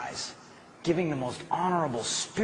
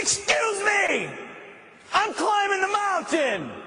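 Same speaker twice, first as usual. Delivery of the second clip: shouted declaration; slower than usual. Shown as a demonstration. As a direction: proclaim it with full force. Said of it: "and you say".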